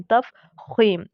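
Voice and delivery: तफ़ख़ीम spelled out slowly, each syllable clear; slow clear term. Shown as a demonstration. Teacher pronouncing the term slowly for students. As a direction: draw out तफ़ख़ीम for students to copy. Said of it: "इस तरह से ये word है तफ़ख़ीम".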